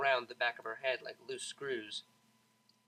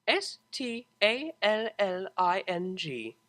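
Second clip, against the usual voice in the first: slow, deliberate letter by letter; spelled out slowly; 4 semitones higher, 5 dB louder, slower than usual. Acted. Octavia spelling the word for the Pegasus, slow and impatient. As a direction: spell each letter with strained patience.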